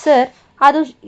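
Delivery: slow, scared halting words; a timid clerk caught out, faltering; scared employee stalling before his boss; frightened hesitation. A narrator's reading. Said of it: "Raghu ಗಾಬರಿಯಿಂದ".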